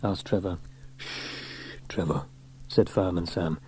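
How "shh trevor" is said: soft, low shush; hushing. A narrator's reading. Sam silencing Trevor before the draw, hushing. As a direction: shush him discreetly.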